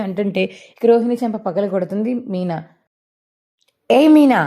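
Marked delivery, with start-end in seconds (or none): 3.9-4.4 sharp, higher yell of a name; loud shout. Acted. Mother-in-law shouts at Meena after the slap, loud. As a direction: shout her name to stop her. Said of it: "గట్టిగా అరుస్తుంది ప్రభావతి".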